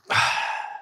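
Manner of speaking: soft, long exhale; winding-down sigh. Real, not acted. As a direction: let the breath go as you slow down. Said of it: "I'm slowing down a bit".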